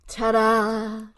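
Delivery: loud, drawn-out ta-da; showy announcement; grand reveal. Acted. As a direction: present yourself with a loud flourish. Character makes grand entrance.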